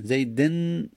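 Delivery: "زیدن" as zayden with a held n; nun sakin. Shown as a demonstration. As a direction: linger on the final n of "زیدن". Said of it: "نون ساکن".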